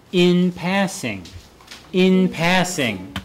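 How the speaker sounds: slow, "in" held, "passing" falling; stress on IN. Shown as a demonstration. Teacher models a digression phrase for students, slowly stressing "in". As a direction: stretch "in", then set down "passing".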